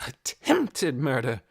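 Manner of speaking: slow, outraged repetition; quivering outrage. A narrator's reading. Missus Beeson echoing the charge in outrage. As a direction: shake with outrage on each word. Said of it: "a voice that quivered with outrage".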